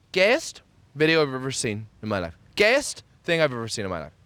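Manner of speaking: usual speaking voice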